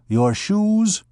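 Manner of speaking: a hint of a coarse wench demanding; rough wench. Big wench demanding Gringoire's cloak, lightly voiced.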